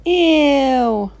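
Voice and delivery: put-on a child grossed out by the burp; disgusted. A narrator's reading. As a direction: recoil in disgust.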